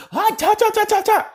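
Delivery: very high yelping cries of pain; voicing the sludge villain burning; burning yelps. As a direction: squeal like you're on fire.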